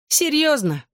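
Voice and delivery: very high-pitched incredulous scorn; hissed disbelief. A narrator's reading. Bella scorns Johnny's choice of girl, high incredulous venom. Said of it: "прошипела Белла, готовая уничтожить меня взглядом".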